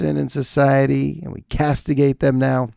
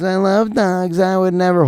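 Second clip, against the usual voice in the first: self-righteous; 6 semitones higher than usual. Retold or imitated. Mocking his own dog-lover pose, self-righteous. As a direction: sound smugly offended. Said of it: "this is the guy who goes".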